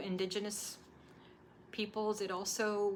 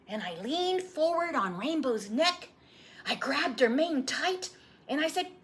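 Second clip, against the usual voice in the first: put-on lightly higher eager boy; an eager young boy begging for the story; young boy voice, a little higher; boy's voice; 7 semitones higher, 7 dB louder than usual. Boy retells the race.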